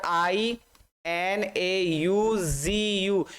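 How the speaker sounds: letters spelled out one by one, very slowly; slow spelling. Shown as a demonstration. Teacher spelling for students, very slow and drawn out. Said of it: "teacher spelling out letters".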